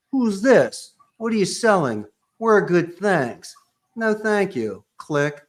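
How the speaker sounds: curt brush-off. Retold or imitated. Acting a negative phone reaction.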